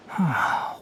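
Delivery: soft unvoiced falling sigh. Tighnari to the machine, a soft puzzled sigh. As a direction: let out a quiet puzzled sigh.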